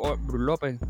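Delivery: ordinary talk, nothing marked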